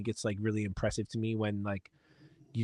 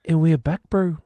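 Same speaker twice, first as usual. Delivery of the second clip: higher, louder mock Kiwi accent; 6 semitones higher, 9 dB louder than usual. Host redoing the return in a Kiwi accent. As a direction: play it up Kiwi style, bro. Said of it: "in a Kiwi accent".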